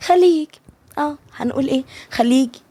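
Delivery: sly. A narrator's reading. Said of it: "مليكة بخبث".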